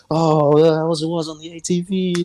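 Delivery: high put-on voice; imitated party dad. Dad chatting to everyone at the party, high imitated voice. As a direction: pitch it way up, caricaturing the dad.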